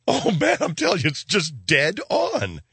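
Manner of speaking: high-pitched, exaggerated gushing; mock raving. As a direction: rave in a high put-on voice, drawing out the end. Mock raving about friend's impression.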